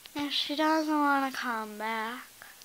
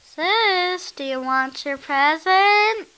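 calling out; 3 semitones higher, 11 dB louder than usual. Acted. Calling sister.

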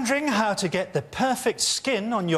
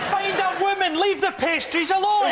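mock scolding shout; 10 semitones higher than usual. Shouting back at the role.

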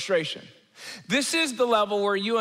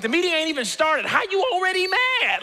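high-pitched baffled protest; acted-out exasperated disbelief; incredulous; 8 semitones higher than usual. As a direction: ask it in rising, exaggerated disbelief. Voices baffled reply to pre-mad person.

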